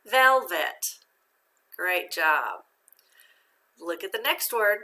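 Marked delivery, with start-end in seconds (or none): 0.1-1.0 "Velvet" higher and louder, strong first syllable; loud, careful "Velvet" from its two parts; syllables read together. Shown as a demonstration. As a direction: blend "Velvet" as a clear read-out. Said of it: "Read it together".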